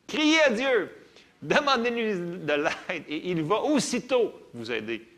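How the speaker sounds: loud, high and slow call to cry out; rally the congregation; fervent exhortation. Real, not acted. Preaching exhortation at full force. (exhortation fervente, forte et lente)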